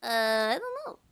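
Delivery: put-on timid sweet anime boy. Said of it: "more like sweet anime boy".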